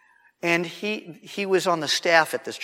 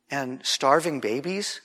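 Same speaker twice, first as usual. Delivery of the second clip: ordinary delivery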